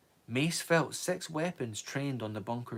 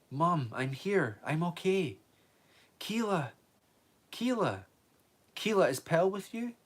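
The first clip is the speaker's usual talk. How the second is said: calling out; 6 semitones higher than usual. Acted. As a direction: call out to mother and friend. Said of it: "calling out".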